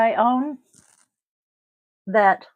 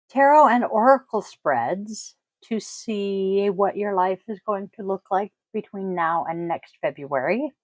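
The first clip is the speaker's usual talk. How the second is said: usual speaking voice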